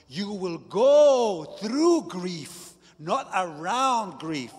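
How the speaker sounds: slow, loud, emphatic preaching; big sermon voice quoting Jesus; preaching at full force. Acted. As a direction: drive each word home from the pulpit.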